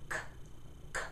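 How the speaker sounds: "K" made at the back of the throat; K sound. Shown as a demonstration. Teacher modelling the K sound. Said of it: "do it with me".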